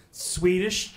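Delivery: normal talking voice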